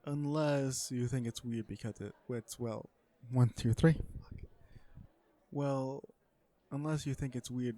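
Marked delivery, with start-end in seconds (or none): 3.3-3.9 loud count-in. Real, not acted. Breaks off take to count in.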